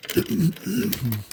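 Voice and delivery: rough throat-clearing grunt. Real, not acted. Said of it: "Sorry about the grunting".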